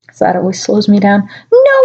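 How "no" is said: hammed-up scream. A narrator's reading. Reader shrieking Ebony's no at the news. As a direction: shriek the no in melodrama. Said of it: "I screamed".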